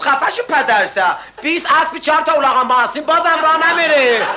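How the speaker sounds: high hammed-up outburst; mock angry shout. Buyuk yells at the car salesman, high mock fury.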